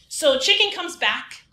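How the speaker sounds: ordinary talk, nothing marked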